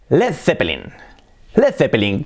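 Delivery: "Led Zeppelin" as Spanish le-the-pe-LIN; Spanish-style pronunciation. Shown as a demonstration. Teacher reading out the band name the Spanish way, hispanicized. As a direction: say "Zeppelin" with Spanish th and every E sounded. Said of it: "cómo se dice en español".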